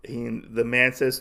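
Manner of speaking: ordinary talk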